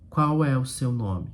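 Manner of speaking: "Qual é o seu nome" said low and level, like a statement; without question intonation. Shown as a demonstration. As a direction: ask "Qual é o seu nome" with no questioning lift, pitch kept down. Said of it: "fazer uma entonação sem ser entonação de pergunta". (sem entonação de pergunta, "Qual é o seu nome" em tom baixo de afirmação)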